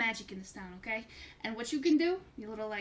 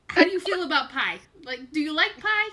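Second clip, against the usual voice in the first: carnival barker; 3 semitones higher, 9 dB louder than usual. Acted. NPC pitch, loud.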